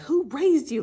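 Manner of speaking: high, loud indignant quote; imitated outburst. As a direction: mimic someone going off on her. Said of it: "they go off on her".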